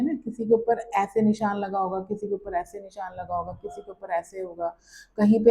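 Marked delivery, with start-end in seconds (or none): none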